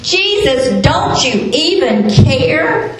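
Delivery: acted pleading, stretched out; mild desperate plea. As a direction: draw out the question, lightly imitating desperation. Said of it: "they came to him in desperation".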